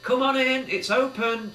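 high, loud call to the door; cheerful shout through the door. A narrator's reading. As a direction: call out that it's open. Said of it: "shouted Billy".

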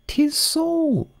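high grand duchess voice; imperious plummy grande dame. Acted. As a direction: purr like a grand old duchess.

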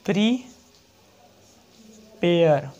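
very slow, drawn-out word; teacher dictating an example; slow dictation. Shown as a demonstration. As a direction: stretch the word for the class. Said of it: "पहला example है".

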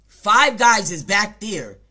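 mock demanding girl. Girl's demanding line about food.

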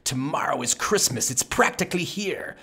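sneering villain snarl. Acted. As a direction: spit it out with contempt. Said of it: "he snarled with a sneer".

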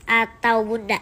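ordinary delivery

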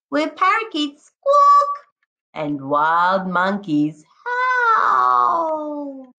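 lively, sing-song picture-book read-aloud; expressive storybook voice with a howl at the end; reading to children. Acted. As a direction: paint each jungle animal for the class. Teacher reads picture book aloud.

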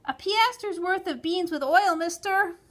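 put-on high, loud child's call; shrill little customer calling out; child's shop order. A narrator's reading. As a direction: pipe up over the counter. Child calls order to seller.

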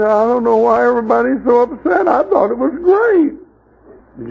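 lightly voiced simple delight; innocent healed man. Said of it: "And he said".

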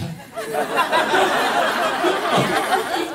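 high burst of laughter, rising; big rising laugh. Retold or imitated. Retelling the friend's laugh, a big rising laugh. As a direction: laugh out loud, high and delighted.

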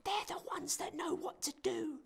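high, breathless whisper; creeping nightmare whisper. Acted. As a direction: whisper it like a secret in the trees. Same whispering voice continues.

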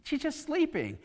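high-pitched, baffled disbelief; incredulous mourners. Acted. Mourners privately doubting what Jesus told them, incredulous. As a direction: question it in a high, puzzled voice. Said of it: "that's exactly what they're thinking".

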